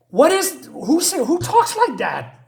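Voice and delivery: high, loud disbelieving laugh-through; incredulous laugh. Real, not acted. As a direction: laugh at how absurd the line is. Mocking the comic's dialogue.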